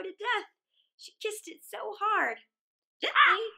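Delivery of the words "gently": higher, louder crow pleading gently; a crow squawking to be let go. A narrator's reading. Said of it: "said the crow".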